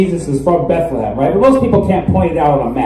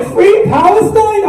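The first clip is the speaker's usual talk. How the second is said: put-on drawn-out, much higher little-girl question; a slow kid voice, wide-eyed and hopeful; imitated five-year-old niece; 13 semitones higher, 3 dB louder, much slower than usual. Niece asking for her Christmas gift, slow high kid voice. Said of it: "She's like".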